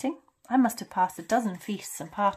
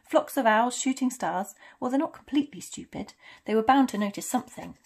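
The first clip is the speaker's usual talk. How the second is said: louder, lifted scolding voice; indignant; 2 semitones higher, 6 dB louder than usual. Acted. McGonagall complains about shooting stars in Kent, indignant.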